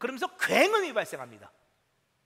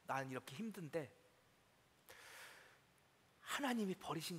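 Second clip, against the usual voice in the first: hushed lament; 5 semitones lower, 7 dB quieter than usual. Acted. Psalmist's lament voiced by preacher.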